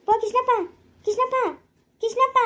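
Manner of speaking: high calling out to Krishnappa. Acted. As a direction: call his name from afar, rising. (ದೂರದಿಂದ ಕೂಗುವುದು)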